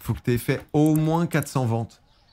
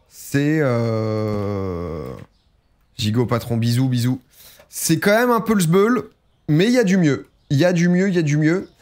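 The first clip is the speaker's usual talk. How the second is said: excited sign-off; 5 semitones higher, 4 dB louder than usual. Real, not acted.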